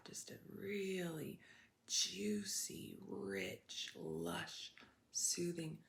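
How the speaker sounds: luxuriate in each word, pausing between; savouring, soothing. Shown as a demonstration. Teacher describing the soothing breath, slow and savouring.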